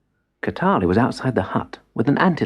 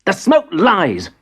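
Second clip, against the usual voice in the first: higher, slow defiant shout; 10 semitones higher, 4 dB louder, slower than usual. Acted. Boy shouts at grandfather, higher and defiant. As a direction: hurl the denial at him. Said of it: "shouted Madubi".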